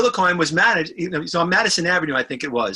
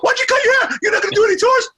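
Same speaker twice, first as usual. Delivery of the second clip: high, loud upset squeal; 4 dB louder than usual. Retold or imitated. Imitating [name] pleading with [name], high and loud. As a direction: wail it up high in disbelief.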